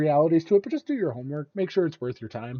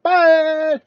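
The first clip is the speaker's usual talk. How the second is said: cheery goodbye; 9 dB louder than usual. Real, not acted. Sign-off.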